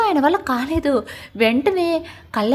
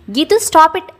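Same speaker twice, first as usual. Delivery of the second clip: sharp, louder and higher stop command; loud shout; 5 semitones higher, 6 dB louder, much slower than usual. A narrator's reading. Jai yells at Geetu to stop crying, loud shout. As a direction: cut her off with a yell. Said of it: "అని గట్టిగా అరిచేసరికి".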